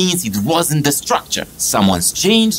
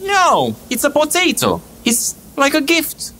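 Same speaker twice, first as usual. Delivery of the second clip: insistent; 12 semitones higher than usual. Acted.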